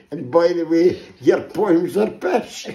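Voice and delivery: landlady yelling after the thrown-out poet; shouting after him. Retold or imitated. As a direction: bellow it at his back as he goes. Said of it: "shouts after him".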